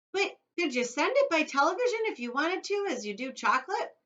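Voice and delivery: put-on high, loud pushy kid; boy pestering Wonka with questions; eager boy. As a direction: press him hard like a bratty boy. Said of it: "asked Mike T V".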